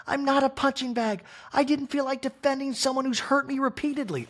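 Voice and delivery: a hurt, high-pitched complaint; hammed-up Kyle impression; mock Kyle.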